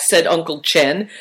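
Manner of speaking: ordinary delivery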